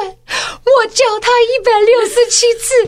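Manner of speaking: put-on whiny sobbing kid pleading her case; tearful little girl. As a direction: sob it out as the wronged little sister. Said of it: "崩泪这样子说".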